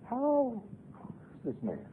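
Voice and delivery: usual speaking voice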